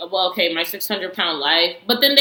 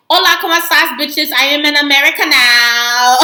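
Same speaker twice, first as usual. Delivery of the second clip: much higher, louder, showy greeting; mock Glitter Heels entrance; 7 semitones higher, 7 dB louder than usual. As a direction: ham up her grand arrival. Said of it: "And then she's like".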